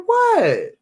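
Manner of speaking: high, loud shocked squeal; shocked what. Retold or imitated. Wife to husband refusing her food, high shocked squeal. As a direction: squeak it out in disbelief. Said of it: "And you like".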